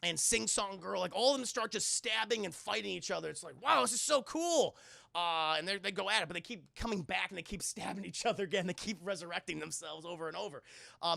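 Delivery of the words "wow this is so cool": high, loud gush of delight; wowed excitement. Retold or imitated. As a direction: exclaim it like an amazed spectator. Acted delight at killers brawling.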